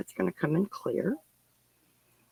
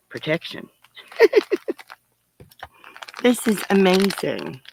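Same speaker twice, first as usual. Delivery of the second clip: ordinary talk, nothing marked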